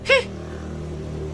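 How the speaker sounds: a quick airy laugh; breathy laugh. Acted. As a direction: laugh it out under your breath. Laughs at the detailed price list. (naggaraw a katawa)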